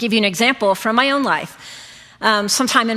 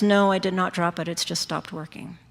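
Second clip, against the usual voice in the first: calm denial; 2 semitones lower, 7 dB quieter than usual. Retold or imitated. Accused customer answering the store clerk, very calm and quiet. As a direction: answer the accusation calmly, voice dropping softer. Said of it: "just very calmly stands there and says".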